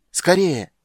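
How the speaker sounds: high, loud cry; urgent shout. A narrator's reading. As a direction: shout in desperate haste. Urging flight across collapsing bridge.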